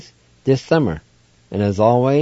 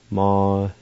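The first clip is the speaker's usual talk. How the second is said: normal talking voice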